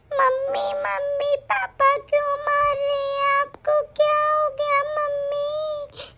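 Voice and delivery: put-on high, slow child's voice, near tears; a scared small girl pleading, slowly and tearfully; a daughter crying to her mom, high and slow; tearful little girl. Frightened daughter asks about beating.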